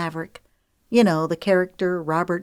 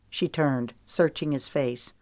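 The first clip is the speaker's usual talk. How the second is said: ordinary delivery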